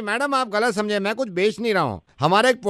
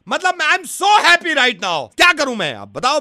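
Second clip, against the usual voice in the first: heated complaint at raised pitch; argumentative tone; 4 semitones higher than usual. Acted. Suitor insists he is in a great mood, argumentatively. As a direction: claim to be happy while sounding cross. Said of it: "tone है ये".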